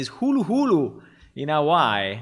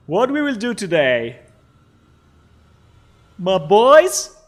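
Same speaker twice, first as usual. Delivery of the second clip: hyped; 7 semitones higher, 10 dB louder than usual. Real, not acted. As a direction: pump up the audience. Hype stream opener.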